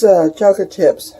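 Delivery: usual speaking voice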